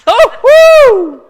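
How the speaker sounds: very loud high whoop; mocking hoot. Jebusites hooting at the boy, mocking whoop. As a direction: hoot with scorn.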